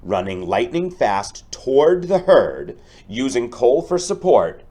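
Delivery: building up the stampede, bright and energetic; lively storybook read-aloud. Acted. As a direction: make the rescue exciting for the kids. Teacher reads picture book aloud.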